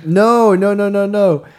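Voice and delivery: high, loud string of nos; excited repeated no. Real, not acted. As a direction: shout them down, laughing.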